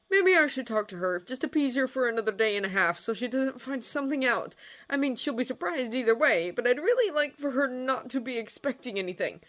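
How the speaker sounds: mildly fretful planning; Hiccup pondering. A narrator's reading.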